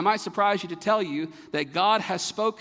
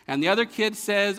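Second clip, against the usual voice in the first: usual speaking voice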